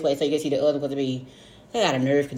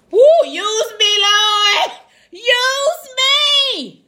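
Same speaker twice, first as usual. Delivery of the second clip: high shouted church cry; mock preacher cry; 6 dB louder, much slower than usual.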